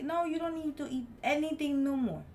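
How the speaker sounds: heated mock rant; disgusted rant. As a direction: scold him for slobbering. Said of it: "And I'm like".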